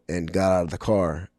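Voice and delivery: normal talking voice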